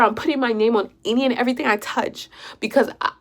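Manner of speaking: ordinary talk, nothing marked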